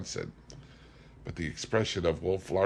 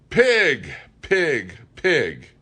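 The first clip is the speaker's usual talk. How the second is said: furious screamed insult, much higher and louder; yelled at the top of his lungs; 9 semitones higher, 9 dB louder, much slower than usual. A narrator's reading. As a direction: bellow it with all your breath. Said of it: "at the top of his lungs".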